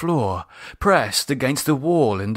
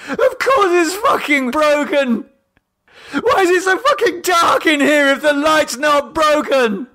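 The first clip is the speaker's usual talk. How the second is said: high, loud, tearful shouting; crying furious yell; 5 dB louder than usual. A narrator's reading. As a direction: scream it through tears. David screaming, crying.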